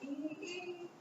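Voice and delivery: each E sung separately, clipped apart; choppy E's. Retold or imitated. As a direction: imitate E, E sung as two disconnected sounds. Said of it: "You're even singing the E's different".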